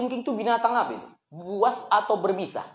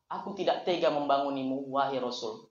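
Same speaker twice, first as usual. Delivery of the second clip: much softer and lower, devoted; tender reply; 7 semitones lower, 8 dB quieter than usual. Acted. Abu Bakar tenderly explains to the Prophet why he did not wake him. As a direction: say it gently, full of devotion.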